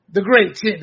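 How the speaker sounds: mock praise, sarcastic; sarcastic fake compliment. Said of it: "I'm being sarcastic".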